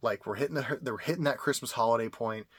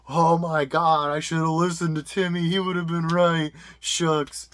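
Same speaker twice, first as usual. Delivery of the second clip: loud, slow, hammed-up lament; mock regretful buyer; 5 semitones higher, 9 dB louder, slower than usual. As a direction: wail like a future regretful buyer. Imagined future buyer lamenting.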